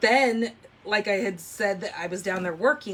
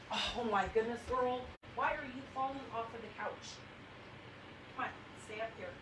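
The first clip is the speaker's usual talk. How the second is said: much softer, from across the room; off-mic to daughter; 2 semitones higher, 15 dB quieter, faster than usual. Real, not acted. Mother telling her daughter on the couch not to fall, far off and soft.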